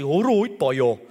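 hint of Brummie and Welsh, cheeky greeting; Brummie Welsh mix. Imitating his fifteen-year-old son's Brummie-Welsh greeting. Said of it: "a little bit of Brummie and a little bit of Welsh".

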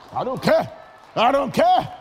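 ordinary talk

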